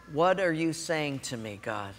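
soft, hushed question to God; quiet prayer. Retold or imitated. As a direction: ask God gently and quietly.

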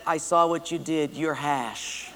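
low, soft gloating sister; smug little sister voice; sly threat. Acted. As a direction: quietly lord it over him. Sister catches brother out.

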